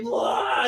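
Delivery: high, blurted vomiting noise; mock retching. Acting out Charlie's second spew of blood, mock retch. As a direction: heave it out like throwing up. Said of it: "he does it up again".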